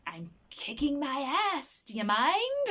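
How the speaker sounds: hint of affronted Jim Carrey; indignant Fletcher. Fletcher tells the toilet stranger off, affronted.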